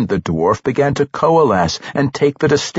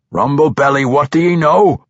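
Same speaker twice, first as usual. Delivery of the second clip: urgent dwarf; 5 semitones higher, 4 dB louder than usual. Acted.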